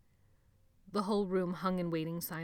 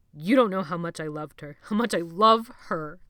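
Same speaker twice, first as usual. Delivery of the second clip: louder, tearful outburst; anguished grief; 11 dB louder than usual. A narrator's reading. Jonathan crying about his sister, loud grief. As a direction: pour out the grief.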